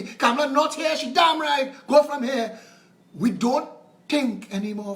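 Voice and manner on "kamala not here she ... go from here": mock rant. Ranting about party loyalty.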